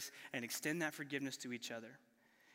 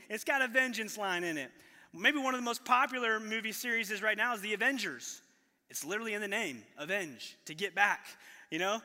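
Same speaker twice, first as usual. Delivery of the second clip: normal talking voice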